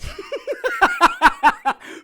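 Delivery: very high loud cackle; maniacal cackle. Acted. Kay cackling before the torture, high maniacal cackle. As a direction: cackle with glee.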